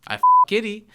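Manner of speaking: high, loud bragging; loud proud boast. Retold or imitated. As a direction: shout it out proudly. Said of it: "repeats the quote".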